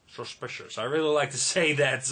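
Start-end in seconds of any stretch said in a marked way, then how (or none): 0.1-0.8 Sean Connery impression. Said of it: "I sound more like Sean Connery when I actually say his voice".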